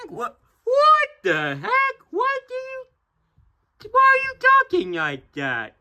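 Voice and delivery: very high-pitched interruption; squeaky character, baffled. Acted. Listener objecting to the reader's voice, squeaky and baffled. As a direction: squeal it in tiny-voiced disbelief.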